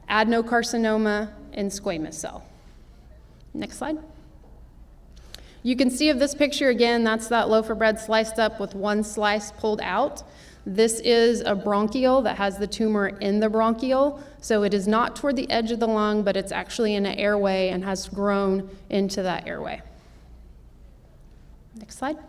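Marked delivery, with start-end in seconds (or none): 3.5-4.0 softer, rising cue to the slide operator; offhand murmured cue; quiet aside. Real, not acted. 21.8-22.2 softer, rising cue to the slide operator; offhand murmured cue; quiet aside. Real, not acted.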